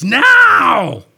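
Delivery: loud, high drawn-out scream; sudden full-voiced, high shout; old man's scream. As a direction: bellow it like an old man screaming in someone's face. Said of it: "screaming in my face".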